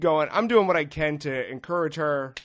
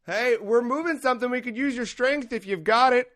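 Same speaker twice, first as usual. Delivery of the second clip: higher, bright mock neighbor asking sweetly; imitating the neighbor; 8 semitones higher than usual. Neighbor asking a favor of the comic, mock sweet voice. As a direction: lightly voice the neighbor asking for help. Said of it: "She goes".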